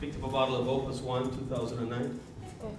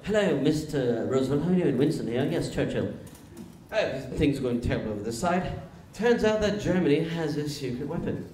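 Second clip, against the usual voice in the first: British statesman on a phone call; Winston Churchill. Acted. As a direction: put on a pompous British accent. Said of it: "Winston calls up Roosevelt".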